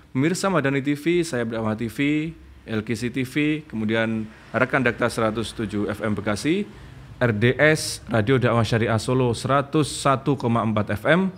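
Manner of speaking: upbeat radio host introduction; announcer read. Acted. Host welcoming viewers and listeners, announcer voice. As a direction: greet the viewers like a broadcast host. Host's opening broadcast announcement.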